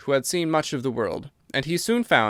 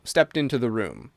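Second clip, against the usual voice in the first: ordinary delivery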